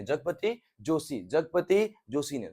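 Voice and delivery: slow, spelled-out repeat of Jagapati Joshi; slow teacher drill of Jagapati Joshi; slow drilled name. Shown as a demonstration. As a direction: say Jagapati Joshi slowly, syllable by syllable. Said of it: "drilling the name".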